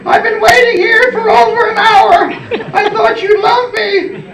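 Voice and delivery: very high falsetto, tearful wife; mock-weepy wife in a squeaky high voice; hammed-up trying not to cry, far above your own pitch; Nancy. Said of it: "this is Nancy, and I'm trying not to cry".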